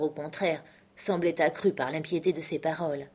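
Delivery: usual speaking voice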